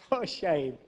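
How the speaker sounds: normal talking voice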